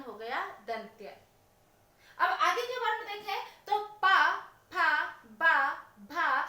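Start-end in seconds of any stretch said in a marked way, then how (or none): 4.0-6.4 पा फा बा भा with lips meeting, a little louder; lip sounds demo. Shown as a demonstration. Teacher shows lips meeting on पा फा बा भा. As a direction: pop पा, फा, बा, भा off closed lips. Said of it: "होठ मिल रहे हैं".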